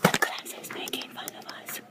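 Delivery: real stage whisper; hissed, voiceless aside. Real, not acted. Aside during class.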